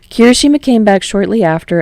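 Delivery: usual speaking voice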